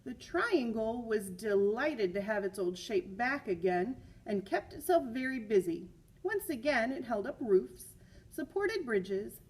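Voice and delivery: put-on lively read-aloud for children; story reading. A narrator's reading. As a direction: read the picture book to the class with energy. Reads picture book aloud.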